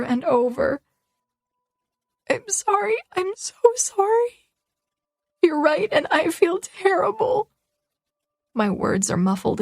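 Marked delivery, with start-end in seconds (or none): 2.3-7.5 much higher, tearful, voice breaking; sobbing apology. Acted. Girl apologizing to Miller through her sobs, tearful. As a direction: choke out the apology through tears. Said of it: "between sobs".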